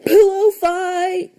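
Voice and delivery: Tacky bursting out of the pillows, shouting. A narrator's reading. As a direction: bellow it out in delight.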